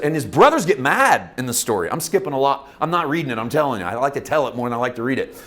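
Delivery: ordinary talk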